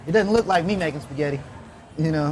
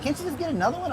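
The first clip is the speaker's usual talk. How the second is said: put-on much higher questioning girlfriend; girlfriend's voice; 10 semitones higher, 5 dB quieter than usual. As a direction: voice the girlfriend, pitched up. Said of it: "My girl was like".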